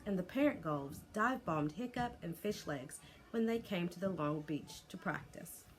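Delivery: usual speaking voice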